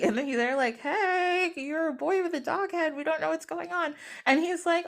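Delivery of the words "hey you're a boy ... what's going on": high, softening singsong voice; mock awkward greeting. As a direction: act out puzzled onlookers in a raised pitch. Acted awkward answer to the boy.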